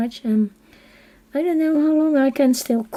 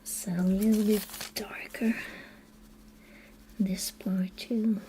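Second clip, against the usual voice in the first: soft whispered aside to herself; 10 dB quieter than usual. Real, not acted. Colorist whispering to herself over the page, hushed. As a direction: breathe it out while concentrating.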